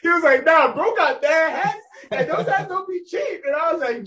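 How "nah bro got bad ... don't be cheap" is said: high, slow, loud mock shout of praise; the friend hollering how pricey the hats are; high-pitched, drawn-out hype shout; hyped friend shouting.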